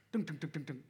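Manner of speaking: rapid "dunk" thuds; imitated engine thumping. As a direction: imitate a knocking motor in quick beats. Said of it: "I hear this".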